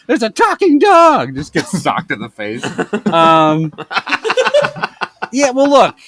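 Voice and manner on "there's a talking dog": high, loud, slow excited shout; excited kid voice. Acted. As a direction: blurt it out like a thrilled child. Kid announcing talking dog.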